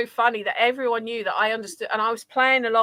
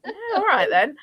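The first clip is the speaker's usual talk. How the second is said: high, laughing go-ahead; giggly mock consent; amused agreement; 7 semitones higher than usual.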